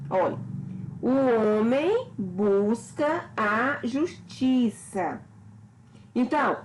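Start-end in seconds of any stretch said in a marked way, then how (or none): 1.0-5.2 slow, measured "o homem busca a justiça", stressed syllables standing out; sentence melody. Shown as a demonstration. Teacher showing the phrase's melody, slow alternating stresses in "o homem busca a justiça". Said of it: "dão melodia à frase".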